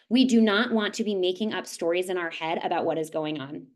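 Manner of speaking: ordinary talk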